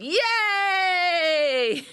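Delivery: high, loud cheer; cheering yay. Shown as a demonstration. Coach leads the group in a cheer, high and loud. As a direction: throw your arms up and cheer. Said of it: "put our hands above our head and go".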